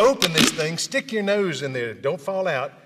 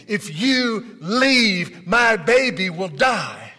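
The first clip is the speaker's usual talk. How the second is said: mother's desperate scream, slowed and higher; 4 semitones higher, 4 dB louder, much slower than usual. Retold or imitated. Mother chasing the truck, screaming plea. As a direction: plead through the dust as she runs. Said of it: "and screaming".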